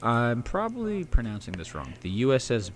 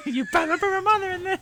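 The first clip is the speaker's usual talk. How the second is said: squeaky meme voice; 3 dB louder than usual. Pretend. Answering in meme voice.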